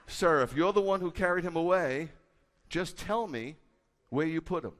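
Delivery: loud, urgent plea; pleading quote. Acted. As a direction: beg him loudly for the body. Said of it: "She's not being huffy".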